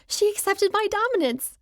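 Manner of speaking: high-pitched triumphant crow; giddy, high little boast; gleeful crowing. A narrator's reading. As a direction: crow it in victory, voice leaping up. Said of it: "I crow softly".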